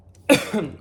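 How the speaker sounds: harsh, loud coughing fit; real choking cough. Real, not acted. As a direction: cough hard, choking on food. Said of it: "I'm choking".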